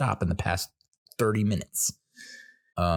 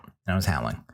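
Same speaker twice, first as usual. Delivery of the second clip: usual speaking voice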